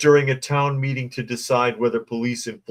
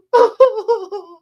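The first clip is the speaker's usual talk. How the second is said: hammed-up high mock sob; 10 dB louder than usual. Mock sob after quote.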